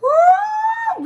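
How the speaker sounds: high loud whooping cry; delighted whoop. Real, not acted. Auntie whooping at her live viewers in delight.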